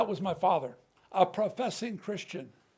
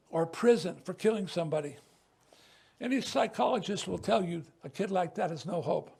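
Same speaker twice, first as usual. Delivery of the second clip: usual speaking voice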